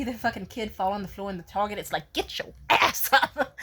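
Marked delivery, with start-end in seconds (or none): 2.1-3.6 slow, loud, breathy parental command. Retold or imitated. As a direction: scold a kid who fell in the store.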